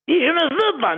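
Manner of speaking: "veux" high and falling, pitched well up; high emphatic preaching. Shown as a demonstration. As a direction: call "je ne veux pas" out high.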